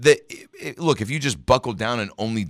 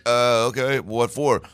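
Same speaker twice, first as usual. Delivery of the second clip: higher, louder retold reply; puzzled office clerk; 6 semitones higher, 6 dB louder, slower than usual. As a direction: play the puzzled person on the line. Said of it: "they're just like".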